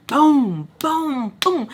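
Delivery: booming imitated door bangs; heavy knocks voiced as booms; loud banging imitation. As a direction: punch out each bang. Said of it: "they're loud. So it's a lot, like".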